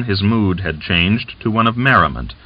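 usual speaking voice

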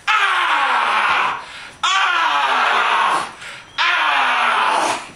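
hammed-up shouted groans of pain; mock pained cries. Gleason stabbed by Norton, crying out in mock pain. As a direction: cry out as if stabbed, hammily.

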